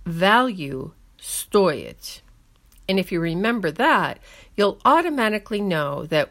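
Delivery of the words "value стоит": very slow, "value" then стоит with stress on СТО; mnemonic stress. Shown as a demonstration. Teacher giving learners a memory trick, slow stressed delivery. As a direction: spell out стоит slowly as СТО-ит. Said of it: "The only mnemonic device".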